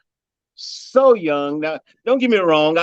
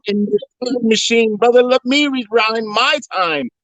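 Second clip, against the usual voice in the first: higher, amazed, searching for answers; stunned disbelief; 7 semitones higher than usual. Retold or imitated. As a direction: act baffled by what you see. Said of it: "I was like".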